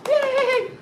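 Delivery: very high, loud yay; same cheer. Retold or imitated. Class cheering a free coffee, very high loud yay. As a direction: cheer as wildly as for the big news. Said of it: "but same".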